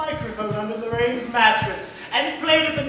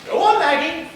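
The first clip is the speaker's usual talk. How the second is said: louder, pushing her to run; urgent call; 3 semitones higher, 6 dB louder, much slower than usual. Acted. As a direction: hurry her out the door. Urging Maggie to the door.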